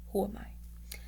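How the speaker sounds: unvoiced, wry whisper; whispered aside. Real, not acted. Storyteller's wry aside to the listeners, whispered. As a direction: mutter it under your breath. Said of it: "aside to listeners".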